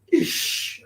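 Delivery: unvoiced hush, breath only; whispered shush. Brother hushing him in the cell, whispered shush. Cellmate warns about the bug.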